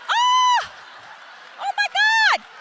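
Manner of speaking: very high, loud thrilled squeal; swooning. Retold or imitated. Mom smitten by the exterminator, thrilled squeal. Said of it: "I was like".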